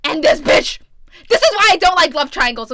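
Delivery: loud, high burst of annoyance; exasperated rant. Real, not acted. Reader fed up with love triangles, exasperated outburst.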